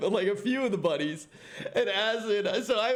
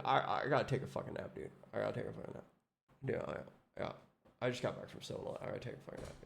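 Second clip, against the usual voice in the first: fading from soft to barely voiced, lower; sleepy mumbling friend; 9 semitones lower, 11 dB quieter, much faster than usual. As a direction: mumble off into a nap. Imitating sleepy Asmon wanting nap.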